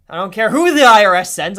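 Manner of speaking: loud, defiant outburst; defiant shout. Real, not acted. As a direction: belt out your refusal to give in. Defiant refusal to pay.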